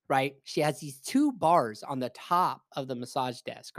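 ordinary talk, nothing marked

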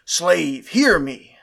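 loud, commanding master's voice; stern master. Acted. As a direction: call your servant like a lord. Master commands slave in dialogue.

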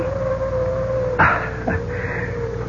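a short relieved laugh after the scare. Acted. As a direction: laugh it off, relieved the danger is over.

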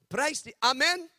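high, loud rising Amen; excited praise. Real, not acted. Preacher rousing the crowd, loud and high. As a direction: lift the room with it.

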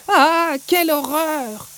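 high, drawn-out shriek of disgust; horrified scream. Acted. Sacha shrieks slowly and high at the snakes on the ground. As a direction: recoil from the snakes. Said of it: "hurle Sacha".

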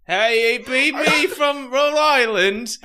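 high, loud, slowed hammed-up British accent; mock British accent. Podcast host tries a Rhode Island accent, hammed-up British. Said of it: "that's definitely way more British".

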